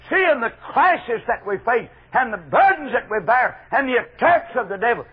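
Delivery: high, loud pulpit delivery; full-force preaching. Real, not acted. Preacher urging the church about its burdens, full-force preaching.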